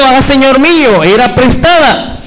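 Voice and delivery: mild preacher's cry of dismay; high, drawn-out lament, not a full shout. Acted. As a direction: raise the pitch in a quoted outcry. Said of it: "y gritó diciendo".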